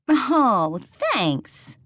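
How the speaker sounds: usual speaking voice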